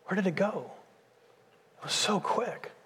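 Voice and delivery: hushed lament. Retold or imitated. Preacher voicing an old person looking back, hushed and wistful. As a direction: let it fade to a near hush. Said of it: "you're gonna say".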